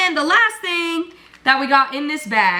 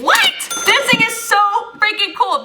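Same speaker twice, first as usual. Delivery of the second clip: high-pitched thrilled squeal; gush, delighted and surprised; excited shriek; 11 semitones higher than usual. Real, not acted. Surprise at free speaker gift.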